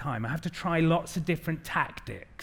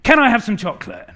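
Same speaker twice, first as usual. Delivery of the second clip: put-on louder, stamping little girl demand; stroppy child demand; 4 semitones higher, 10 dB louder than usual. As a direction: demand it like a cross five-year-old. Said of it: "stamps her feet, puts her hands on her hips and says".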